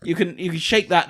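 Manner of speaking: ordinary talk, nothing marked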